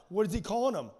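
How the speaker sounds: normal talking voice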